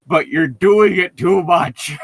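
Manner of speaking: slow, loud, blubbering whine; mock crying guy. Friend mocks the crying hero with a blubbering shout. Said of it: "It's the guy crying".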